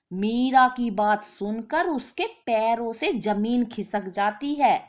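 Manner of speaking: usual speaking voice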